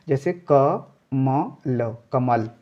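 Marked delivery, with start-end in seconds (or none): 0.5-1.9 क, म, ल said one by one with pauses; split syllables. Shown as a demonstration. Teacher sounds out each letter for the class, slowly. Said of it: "आपको मुंह नहीं खोलना है".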